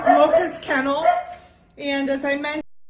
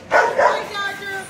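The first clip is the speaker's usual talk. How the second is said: higher, airy hi to a puppy; high puppy greeting; 6 semitones higher than usual. Real, not acted. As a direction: breathe a high hello to the pup.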